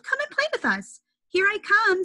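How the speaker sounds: very high happy call; excited call. Acted. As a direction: call out with joy. Fish call happily.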